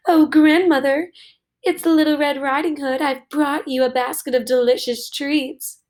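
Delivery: big bad wolf sweetly pretending to be the girl; wolf's sweetest voice. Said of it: "used his sweetest voice to call out".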